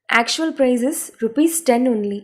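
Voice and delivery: slow, careful English sentence; slow model read. Shown as a demonstration. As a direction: read it slowly for learners to copy. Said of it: "English model sentence, slow repeat".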